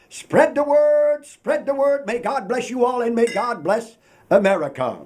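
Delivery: usual speaking voice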